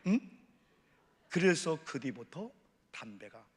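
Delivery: usual speaking voice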